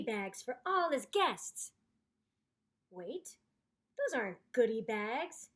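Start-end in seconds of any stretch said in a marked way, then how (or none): none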